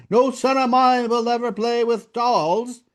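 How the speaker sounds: acting out an outraged father's outburst; retold angry shout.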